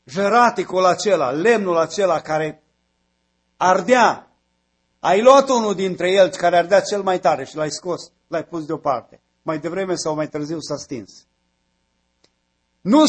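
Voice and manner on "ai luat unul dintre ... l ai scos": usual speaking voice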